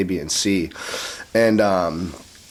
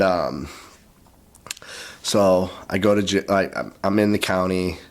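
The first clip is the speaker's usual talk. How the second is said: ordinary talk, nothing marked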